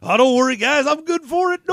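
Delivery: put-on high creaky old-timer pleading; old prospector voice. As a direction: broke old coot begging. Voicing a broke old prospector.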